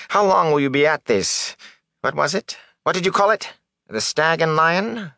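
high-pitched, fishing questions; nervous, probing peddler. Acted. Peddler asking the young man about the inn, nervously probing. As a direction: probe for the inn's name. Voices wheedling peddler Fain.

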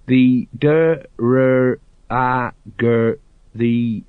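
halting, sounding out letter by letter; slow, labored reading. A narrator's reading. Sergeant Colon deciphers the proclamation, reading very slowly. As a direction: struggle through each syllable, long gaps between. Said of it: "he read slowly".

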